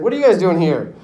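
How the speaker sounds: loud acted demand; startled challenge. Danton to police at his door, startled challenge.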